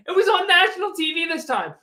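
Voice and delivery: high, loud laughing delivery. Real, not acted. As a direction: laugh through the punchline. Laughing at team's humiliation.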